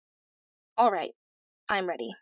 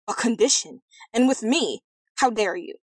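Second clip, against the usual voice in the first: louder, affronted king; acted offence, voice raised; indignant exclamation; 3 semitones higher, 6 dB louder than usual. A narrator's reading. King affronted by the prince's condition, indignant. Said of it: "exclaimed the king".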